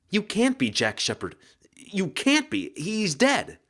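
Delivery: rising high, frightened denial; shocked disbelief. Acted. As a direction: refuse to believe it, voice climbing.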